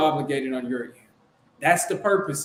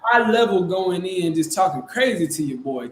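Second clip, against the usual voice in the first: ordinary talk, nothing marked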